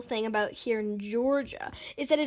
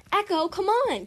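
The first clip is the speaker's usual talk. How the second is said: high, louder call; happy yell; 7 semitones higher, 5 dB louder than usual. A narrator's reading. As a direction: call a friend over happily. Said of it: "Coco yelled, happily".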